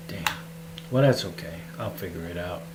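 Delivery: ordinary talk, nothing marked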